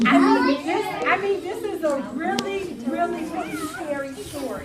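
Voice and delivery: storyteller hyping the scary tale; hyped buildup. Acted. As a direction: sell how scary it is.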